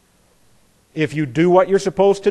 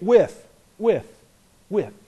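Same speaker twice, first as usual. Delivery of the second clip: each with said sharp and short, higher; stressed with; 3 semitones higher, slower than usual. Shown as a demonstration. Teacher contrasting with against or for the class, stressing each with. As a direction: tap out with, with, with. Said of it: "this is why I emphasize things".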